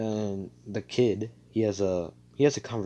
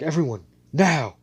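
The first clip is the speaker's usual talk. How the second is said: sharp raised call to the group; urgent command; 6 semitones higher, 7 dB louder than usual. Acted. Leader ordering his comrades, sudden loud command. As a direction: bark the order so all hear it.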